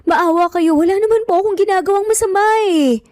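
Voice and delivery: high, fast, tearful begging; tearful pleading. A narrator's reading. Andrea pleads with her attacker, near tears. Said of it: "Naluluha na nga nasambit ni Andrea".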